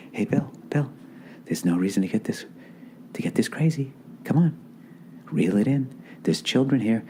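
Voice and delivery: soft, hushed inner voice; voice of reason. Retold or imitated. Conscience in back of his head to himself, quiet reasoning. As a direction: gently talk him down, barely above a murmur. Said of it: "that voice of reason".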